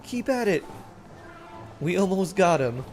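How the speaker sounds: high loud encouragement; excited cheering. Real, not acted. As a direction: push the team on. Cheering her units.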